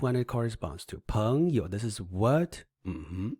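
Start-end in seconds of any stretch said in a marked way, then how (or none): none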